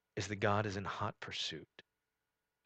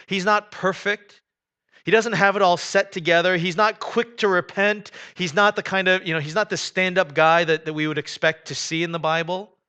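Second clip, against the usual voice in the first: preaching at full force; 8 semitones higher, 12 dB louder than usual. Acted. Pastor to congregation about Jonah, preaching at full force. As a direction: drive each "not" home to the congregation.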